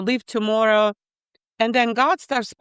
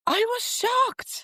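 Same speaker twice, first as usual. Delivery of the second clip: stunned; 12 semitones higher, much slower than usual. Real, not acted. Recalling being stunned.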